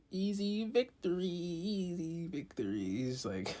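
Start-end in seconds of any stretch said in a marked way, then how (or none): 0.1-1.2 high gloat. Retold or imitated. Repeated gloating line. 1.2-2.8 slow, soft gloat. Retold or imitated. Repeated gloating line.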